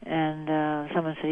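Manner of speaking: usual speaking voice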